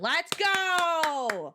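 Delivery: high, drawn-out cheer; excited cheer. Real, not acted. Streamer cheers a viewer finally paid, excited shout. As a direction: stretch out a rising then falling celebratory call.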